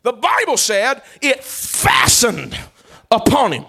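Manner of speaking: high, loud, drawn-out preacher's cry; slow thundering revival preaching; preaching slowly and loudly. Acted. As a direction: stretch each word over the crowd.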